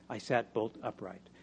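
ordinary delivery, nothing marked

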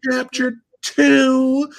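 put-on high, slow, wide-eyed girlish voice; little girl voice. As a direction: play a sweet captive girl, slowly. Voicing the captive girls.

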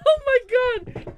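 loud, high cry of disbelief; real shriek of surprise; amazed outburst. Real, not acted. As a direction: exclaim as the answer hits you. Reacting to the riddle's answer.

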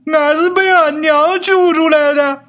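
high, slow tearful cry; tearful wail. Acted. Yinzi tells the neighbours his mother saved him, crying out high and slow. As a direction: let the line break out in a slow wail.

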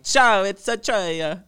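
put-on loud, high, drawn-out Italian accent; hammed-up Italian greeting; mock Italian accent. Imagining arriving in Italy, hammed-up Italian accent. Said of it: "showing up in Italy and I'm like".

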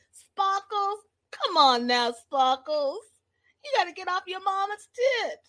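high-pitched mock Boston heckle; teasing imitation Boston accent. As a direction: repeat the taunt in a put-on Boston voice. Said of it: "between the Boston accent".

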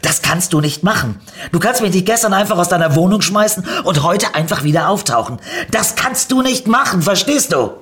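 laute Wuttirade, immer höher steigend; wütendes Schreien. A narrator's reading. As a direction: sich zornig in Rage schreien. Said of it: "schrie Niki weiter". (wütende, sich steigernde Schimpftirade)

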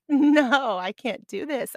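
mock dismayed outburst, jumping high and loud on "No"; near-tears protest. Runner seeing the sweeper cart behind her, retold near-tears protest. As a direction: retell a near-tearful refusal, loud at first. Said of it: "I was about ready to cry".

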